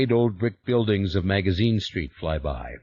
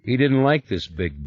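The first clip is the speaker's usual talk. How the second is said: usual speaking voice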